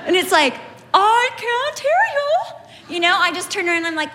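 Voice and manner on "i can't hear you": very high, slow, drawn-out tease; comic singsong. Retold or imitated. Overheard woman teasing the whispering shoppers, singsong. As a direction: stretch it out in a high mock call.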